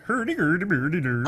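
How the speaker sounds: lightly voiced Muppet Swedish Chef gibberish; a hint of the Swedish Chef babbling. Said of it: "the Swedish chef on the Muppets who was like".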